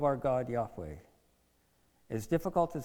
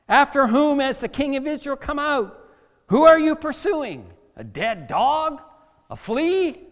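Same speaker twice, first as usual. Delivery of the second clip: loud, high, incredulous questions; David; 11 semitones higher, 11 dB louder than usual. Acted. David challenging the king of Israel, shouted questions. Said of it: "And then David went on".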